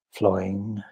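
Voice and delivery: normal talking voice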